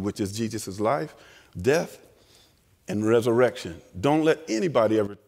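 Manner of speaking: ordinary talk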